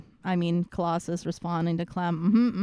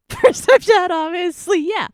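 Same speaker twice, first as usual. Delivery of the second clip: laughing; 9 semitones higher, 9 dB louder than usual. Real, not acted. Laughs at a chatter.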